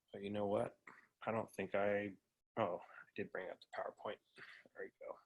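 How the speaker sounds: very soft, under-the-breath aside; talking to oneself. Real, not acted. Presenter setting up screen share, thinking aloud very softly. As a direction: think aloud quietly while busy with a task.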